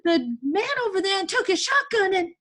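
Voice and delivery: put-on high, loud, urgent boy's voice; excited young boy. Boy urging his plan on chained woman, excited. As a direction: blurt it out high and loud, all worked up. Elijah's excited speech in story.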